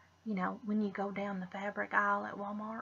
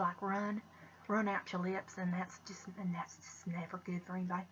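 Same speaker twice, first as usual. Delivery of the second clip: ordinary talk, nothing marked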